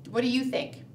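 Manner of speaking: ordinary delivery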